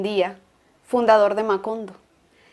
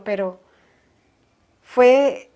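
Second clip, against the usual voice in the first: normal talking voice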